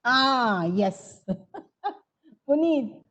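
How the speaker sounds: ordinary delivery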